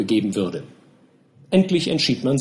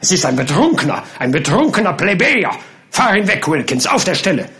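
higher, louder, fast scornful orders; angry captain; 4 semitones higher, 3 dB louder than usual. Acted. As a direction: order him away at once. Captain orders drunk removed. (wütend befehlend)

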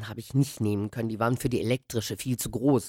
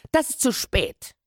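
higher, slower, urgent cry; boy protests to the old man, high and urgent; boy calling out; 10 semitones higher than usual. Acted. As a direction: call out the protest. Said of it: "rief ich wieder".

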